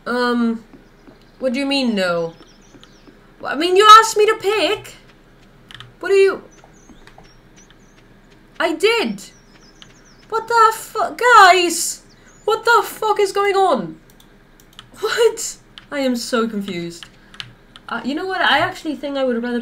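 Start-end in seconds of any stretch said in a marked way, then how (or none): 3.4-9.2 high, loud protest; you asked me, so why complain; indignant shout. Real, not acted. Indignant outburst at game. 10.3-15.6 high, loud what the; shocked yelling. Real, not acted. Startled by game chaos.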